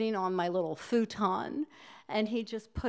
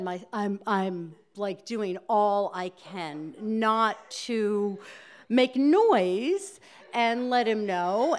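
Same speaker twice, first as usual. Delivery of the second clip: usual speaking voice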